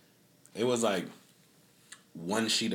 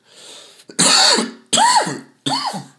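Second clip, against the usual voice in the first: harsh cough; 15 dB louder than usual. Real, not acted. Loud cough.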